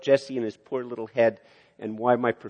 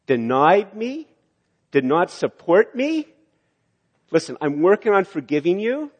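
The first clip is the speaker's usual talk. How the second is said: hurt refusal; 3 semitones higher, 5 dB louder than usual. Pretend. Wounded friend refusing lunch, hurt anger building. Hurt person rejecting the apology.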